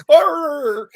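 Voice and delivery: loud, high mock groan of frustration; frustrated groan. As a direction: let out a pained "Arr!". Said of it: "just like".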